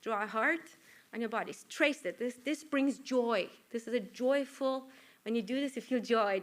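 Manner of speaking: normal talking voice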